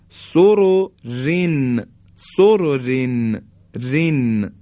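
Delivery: سررن recited slowly, its last R thin; light R. Shown as a demonstration. (سررن کشیده، «ر» آخر نازک)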